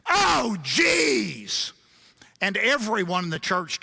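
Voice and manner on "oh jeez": loud, high blurted exclamation; retold loud exasperated outburst. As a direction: blurt it out across the church. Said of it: "a bit louder than a stage whisper".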